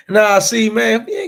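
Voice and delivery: laughing through the words; real laugh while dismissing the price. Real, not acted. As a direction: laugh it off in disbelief.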